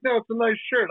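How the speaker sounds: high-pitched mock Florida accent. Florida guy reassures suspicious Jersey man, mock Florida accent. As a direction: insist innocently in a put-on Orlando accent. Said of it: "people don't talk like that in Orlando".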